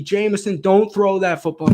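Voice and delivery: half-shouted warning at the screen; yelling at the TV. Retold or imitated. Fan pleading with his quarterback through the TV, retold yell. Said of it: "I'm yelling at the T V".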